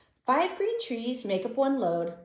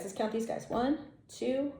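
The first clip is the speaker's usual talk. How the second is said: usual speaking voice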